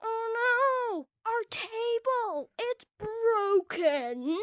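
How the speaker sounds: high, slow hammed-up lament; mock wailing. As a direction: wail it out in fake tearful despair. Mock lament over broken table.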